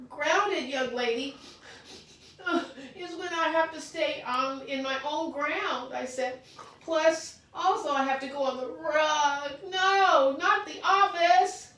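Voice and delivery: high, loud near-crying plea; upset child begging to stay; tearful pleading. Acted. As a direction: beg with tears coming.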